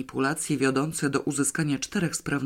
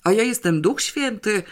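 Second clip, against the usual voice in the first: higher, irritated sarcastic retort; sudden anger; 7 semitones higher, 4 dB louder than usual. A narrator's reading. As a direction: flare up in annoyance. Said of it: "rozzłościł się nagle sprawozdawca". (nagłe rozzłoszczenie)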